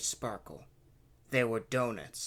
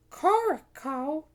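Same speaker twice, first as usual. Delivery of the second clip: high, slow questioning echo; puzzled princess; 14 semitones higher, 6 dB louder, slower than usual. A narrator's reading. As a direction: ask in a high princess voice, rising.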